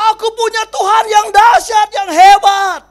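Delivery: high, loud boast of faith; defiant shout. Acted. Old man defying the helicopter rescue, shouting. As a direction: roar it out in defiance.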